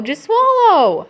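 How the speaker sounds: pleading urge. Real, not acted. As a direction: stretch it out, urging. Mom urging, laughing.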